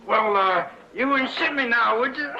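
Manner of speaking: punchline in a squeaky frog voice, cracking up. Retold or imitated. McCabe delivers the punchline to the salesmen as a high frog, laughing. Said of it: "he says".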